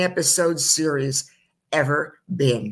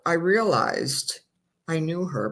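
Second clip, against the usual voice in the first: ordinary delivery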